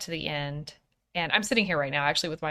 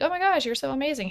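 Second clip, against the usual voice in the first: quick, higher-pitched imitation of fawning; lightly gush, making a big deal of it; rushed, slightly hammed-up fangirl praise; mock gushing; 8 semitones higher, much faster than usual. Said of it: "making this big deal about".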